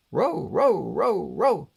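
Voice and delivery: a lightly voiced dog bark, high and loud on each row. Narrator voicing the dogs on the block, a mild barking. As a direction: bark the words out like neighbourhood dogs. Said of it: "went the dogs on the block".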